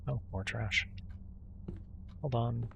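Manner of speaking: normal talking voice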